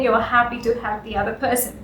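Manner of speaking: ordinary talk, nothing marked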